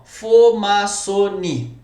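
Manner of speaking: Fomaçoni bem alto, sílaba por sílaba. Shown as a demonstration. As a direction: estique cada sílaba de "Fomaçoni", devagar e forte. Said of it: "eu vou pensar aqui numa". (palavra inventada "Fomaçoni" escandida, lenta)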